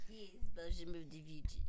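low, badly faked Russian accent; poor Russian accent. Shown as a demonstration. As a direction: fumble through a Russian accent. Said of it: "That's not a very good accent".

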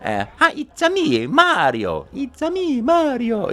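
high Italian-accented cartoon plumber; it's a me in full Mario. Demonstrating the audition, high Mario voice. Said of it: "when I would say".

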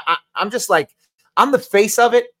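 ordinary talk

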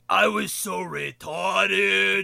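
slow, loud goofy shout; silly voice. Retold or imitated. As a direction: bellow it in a dumb put-on voice. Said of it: "I don't know why I did that voice".

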